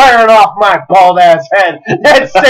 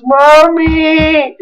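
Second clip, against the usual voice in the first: put-on kid wailing mommy; 6 semitones higher than usual. Kid runs crying to mother.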